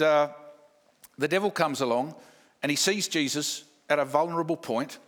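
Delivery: normal talking voice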